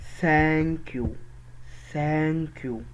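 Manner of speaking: "ثانك يو" with a long open a; vowel stretched downward. Shown as a demonstration. Teacher drilling learners, "ثانك يو" with the a drawn out. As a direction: slowly stretch "ثانك", then drop on "يو". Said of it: "بمد لتحت".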